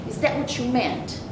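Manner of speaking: usual speaking voice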